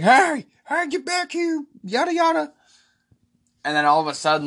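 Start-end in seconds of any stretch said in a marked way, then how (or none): 0.0-2.5 very high-pitched mock calling-out; imitated yelling. Krug yelling for John as he walks away, mock yell. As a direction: mimic a helpless man yelling after someone. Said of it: "Krug's yelling for him".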